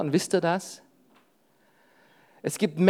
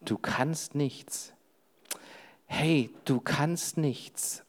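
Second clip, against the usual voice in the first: slow, much softer repeated taunt; whispering thought; 4 semitones lower, 11 dB quieter, much slower than usual. Acted. Preacher repeats the thought that you can do nothing, quiet and slow. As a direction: linger on each word, barely above a breath. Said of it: "der flüstert mir zu".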